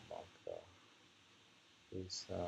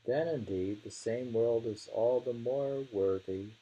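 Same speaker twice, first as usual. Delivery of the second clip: higher, louder recital of the verse; poem reading; 4 semitones higher, 4 dB louder than usual. Acted. Reading the verse again to a friend, declaiming.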